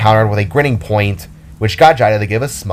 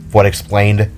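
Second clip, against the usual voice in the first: plain reading voice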